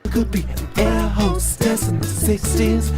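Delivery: ordinary talk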